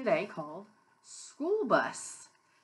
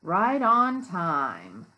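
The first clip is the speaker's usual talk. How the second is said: loud, very slow storybook line; read-aloud to children; 3 semitones lower, 7 dB louder, much slower than usual. A narrator's reading. Teacher reads the bus's arrival to children, slow read-aloud. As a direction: stretch the words out for young listeners. Reading picture book aloud.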